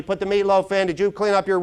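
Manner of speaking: high, loud mock yell at the family. Searcher yelling at the family while web browsing, mock yell. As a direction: call out across the house, half-hearted. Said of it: "you're yelling at somebody".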